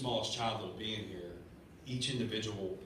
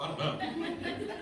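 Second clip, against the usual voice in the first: amused laugh; 13 semitones higher, much slower than usual. Real, not acted. Laughs at childish fears.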